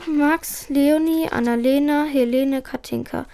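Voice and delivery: ordinary delivery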